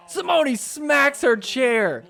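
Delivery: slow, high, loud call of the moment; excited call. Real, not acted. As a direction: punch out the big reaction. Excited commentary on the outburst.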